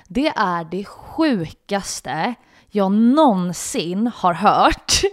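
slow, loud, drawn-out incredulity; hammered-out, staggered astonishment; emphatic disbelief. Retold or imitated. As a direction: stretch each word out in acted outrage. Acted outraged disbelief at his snobbery.

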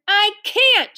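high-pitched protesting cry; angry shout. A narrator's reading. Ramona answers Mother over the barking, angry shout. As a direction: shout back in frustration. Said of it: "shouted Ramona angrily".

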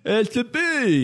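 high, slow simpleton; dopey Higgins. Acted. Higgins explains the bee in a slow goofy voice. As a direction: state it with proud stupidity.

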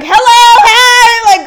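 very loud, high, drawn-out hello; super-friendly greeting. Shown as a demonstration. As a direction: sing out an over-eager greeting. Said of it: "but me, I'm super, like".

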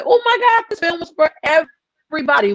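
high-pitched gushing viewer; put-on high, excited exclamation; mock gushing. Imagined viewer gushing over film.

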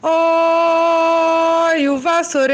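high sing-song hawker cry; street vendor call. Retold or imitated. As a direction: call out like a broom seller.

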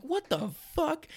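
a man learning he was fired; stunned disbelief. Retold or imitated. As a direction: gasp it out in utter shock. Shocked by a voicemail firing him.

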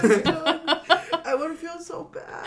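high, slow tearful wail breaking into laughs; really crying, unable to go on; sobbing. Real, not acted. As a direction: sob helplessly while laughing.

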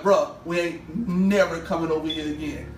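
firm, spaced-out echo of the friend; deliberate retold vow. Repeats homeboy's vow more deliberately.

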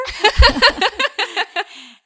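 loud high burst of laughter; laughing. Real, not acted. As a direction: laugh hard at the punchline. Laughs at own punchline.

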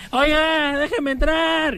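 put-on high pleading drunk; high drunk plea. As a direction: beg at the door like a drunk. Drunk at the door.

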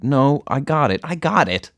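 high, agitated refusal; stubborn insistence. A narrator's reading. Girl refusing boyfriend's help with needle, high stubborn insistence.

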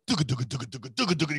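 rhythmic thumping music imitation; imitated speaker beat. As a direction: pound out the drum beat by mouth. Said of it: "big speakers and music playing, going".

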